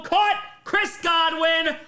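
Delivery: announcer's touchdown shout; touchdown call. Real, not acted. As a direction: call the touchdown at full volume.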